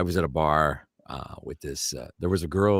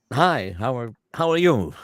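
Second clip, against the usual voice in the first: halting, higher-pitched greeting; William Shatner; 7 semitones higher, 5 dB louder than usual. Retold or imitated. Childhood hero greeting a fan, high halting impression. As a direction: imitate a star's hello, restarting and lifting it. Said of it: "Mister Shatner wants to meet you".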